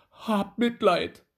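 high, loud begging dwarf; pleading cry. A narrator's reading. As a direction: cry out for mercy. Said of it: "rief der Kleine".

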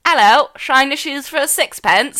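hammed-up Cockney street-urchin accent. Host shows her one line in a Cockney accent. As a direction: pitch it like a mock Cockney shoe shiner. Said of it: "like, Cockney accent".

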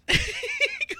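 shrieking delighted laugh; high giddy cackle; high squealing laugh. Real, not acted. Delighted at friend's reaction.

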